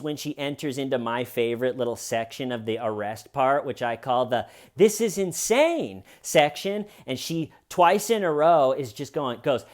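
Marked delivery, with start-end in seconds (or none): 4.8-6.8 high, drawn-out mock outrage; mock enthusiasm. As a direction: imitate her dramatic cry, pitched up and slow. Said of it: "it doesn't have the enthusiasm that the first one has".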